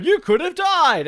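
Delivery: mock dramatic woman. Quoting woman in film.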